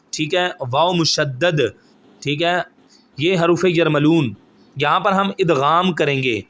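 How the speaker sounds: ordinary talk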